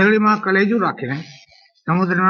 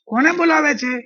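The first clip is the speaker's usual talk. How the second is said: screaming question; 10 semitones higher than usual. Acted. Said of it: "ચીસો પાડી પૂછતો હતો".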